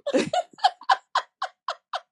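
high-pitched burst of laughter. Real, not acted. Guest reacting to the co-host's agreement, high laugh.

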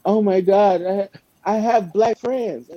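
high, slow mock panic. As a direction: whine it out like a caught liar.

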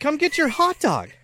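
high, loud call across the lot. Retold or imitated. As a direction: beckon them back, raising the voice. Calling kids back.

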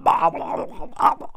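sloppy babbled "blah blah" gargle; mock gobbling noise. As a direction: garble it like a mouth too busy fawning. Mimics the goggling he describes.

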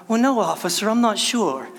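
a touch of faked innocence; playing dumb. Driver pulled over by the cop, playing dumb innocently. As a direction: act clueless to the cop. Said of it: "Do I play dumb?".

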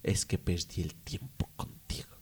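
whispered regret. Retold or imitated. Ex-girlfriend whispering her regret at the end, hushed. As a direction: breathe it out like a confession. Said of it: "te digan".

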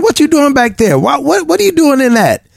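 put-on high, scolding parent calling a child back; mock-scold like a parent to a toddler. Parent scolding little children for poking around back there. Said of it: "how parents tell little children".

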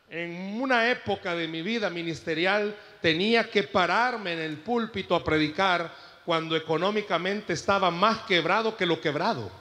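normal talking voice

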